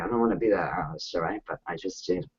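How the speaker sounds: quick embarrassed aside under the breath; sheepish undertone. Real, not acted. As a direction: drop it low, a little embarrassed. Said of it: "I'll be honest here".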